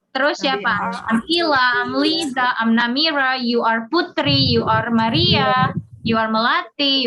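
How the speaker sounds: normal talking voice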